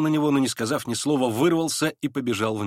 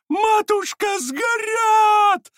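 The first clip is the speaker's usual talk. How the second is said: very high, loud wailing shriek; Лебедев; 6 dB louder, much slower than usual. A narrator's reading. Лебедев wails to his mistress about the money, wailing. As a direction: wail it out, stretching the last word. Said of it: "вопил Лебедев".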